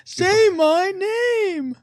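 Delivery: high, very slow and drawn out; dramatic movie line. Pretend. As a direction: draw it out like a film villain.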